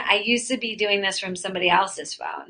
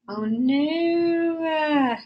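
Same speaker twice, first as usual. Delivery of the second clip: a long, higher "oh no" of dismay; dismayed high wail; 7 semitones higher, 3 dB quieter than usual. Real, not acted. Stream went off.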